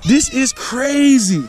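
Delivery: high, excited disbelief; amazed urging. Real, not acted. Angler wanting the fish eggs on camera, amazed.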